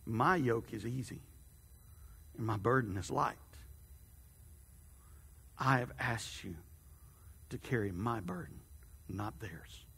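a quiet, tender God offering rest; God's voice. A narrator's reading. Narrator voices God gently.

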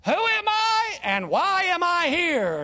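high, slow, drawn-out impression; Admiral Stockdale. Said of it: "he famously said".

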